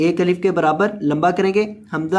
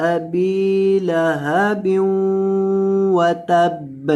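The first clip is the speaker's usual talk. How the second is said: Quran recitation; 3 semitones higher, much slower than usual. Acted. Teacher recites the surah for students, slow melodic recitation. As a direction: chant it slowly in a recitation voice.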